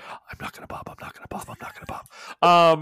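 whispered under the breath, repeated; whispered mantra. Retold or imitated. GM voices a player fighting her nerves, whispered. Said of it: "focusing on".

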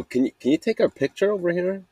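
high bright asking voice; polite request. Retold or imitated. Asking a stranger for a photo, high and eager. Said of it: "we go".

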